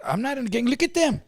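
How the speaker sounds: high-pitched incredulous protest; mock outrage, pitch climbing sharply; indignant denial. Retelling his protest in court about gang claim, indignant.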